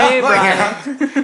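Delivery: hammed-up drag hello. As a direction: playfully imitate a drag-queen hello. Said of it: "imagining you and Drag like".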